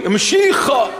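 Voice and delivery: Mshicha shouted high, with a strong sh and guttural ch; Aramaic pronunciation. Shown as a demonstration. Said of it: "teach them to pronounce it correctly".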